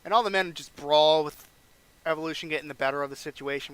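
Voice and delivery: usual speaking voice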